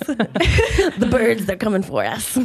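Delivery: a hint of a paranoid conspiracy theorist; lightly voiced suspicious conspiracy theorist. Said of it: "My conspiracy theorist voice".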